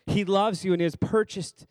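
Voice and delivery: building, insistent sermon delivery; emphatic preaching. Real, not acted. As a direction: preach it, drawing out the key words.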